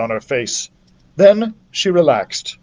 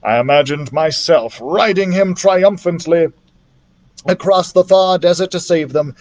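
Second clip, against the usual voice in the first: usual speaking voice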